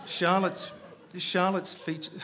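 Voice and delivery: corpsing mid-sentence; laughing. Real, not acted. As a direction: try to resume reading through real giggles. Laughing while trying to resume.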